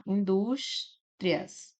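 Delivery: "indústrias" split slowly as in-dús-tri-as; "indústrias" said very slowly with each syllable apart; syllabified slowly. Shown as a demonstration. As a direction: break "indústrias" into separate slow syllables. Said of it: "dessa forma".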